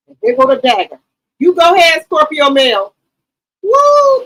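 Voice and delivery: loud, high hyped cheering. Real, not acted. Streamer hyping up Mel's fierce side, loud cheering. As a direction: cheer it on loudly.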